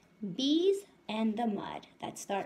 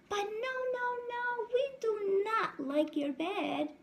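alarmed bees; 12 semitones higher than usual. Acted. Bees protest the mouse ducking under mud, high alarm. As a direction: cry out like startled bees.

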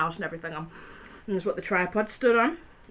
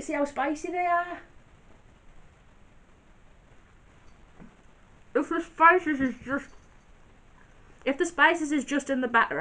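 chewing while talking; eating a nugget; mouth full. Real, not acted. Seen 0: Bites orange nugget at 0.3, chews while talking throughout.

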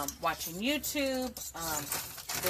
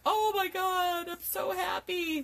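high, slow, gushing joy; gushing happy; 11 semitones higher, slower than usual. Retold or imitated. Watching somebody win, gushing high and slow with joy. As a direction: melt with joy over someone else's win. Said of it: "'Cause I'm like".

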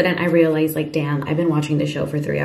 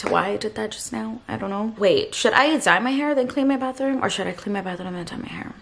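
ordinary talk

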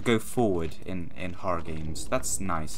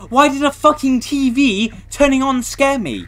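high, loud rattled cry; startled outburst; 11 dB louder than usual. Real, not acted. Spooked by a TV turning on, a high startled outburst. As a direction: blurt it out still jumpy.

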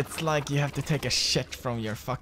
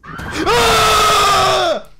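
piercing high, very loud scream; long full-force shriek; throat-straining scream; 14 dB louder than usual. Real, not acted. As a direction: scream so hard it hurts your throat. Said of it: "That fucking hurt my throat".

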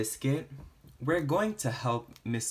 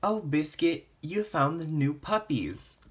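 plain reading voice

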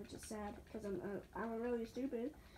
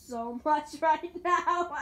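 laughing; 4 dB louder, slower than usual. Real, not acted. Laughing at own failure.

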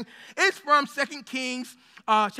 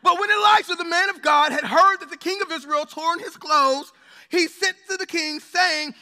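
measured, raised voice reading the Bible passage; scripture reading; 5 semitones higher, 6 dB louder than usual. Acted. Pastor reading Second Kings to the congregation, solemn and raised. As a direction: read it out like holy text to the church. Reads scripture, lifting energy.

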